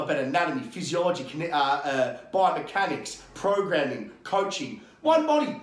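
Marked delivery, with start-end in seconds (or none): 5.0-5.6 higher, much louder, punching the words One body; emphatic shout. Real, not acted. To the interviewer about bodies, a louder, higher One body. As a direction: slam One body hard like a fed-up coach. Ranting about the fitness industry.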